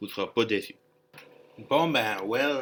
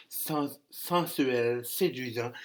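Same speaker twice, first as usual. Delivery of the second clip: mock sensual voice; slower than usual. Host jokingly shows off a lovely voice, mock sensual. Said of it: "sortir ma belle voix".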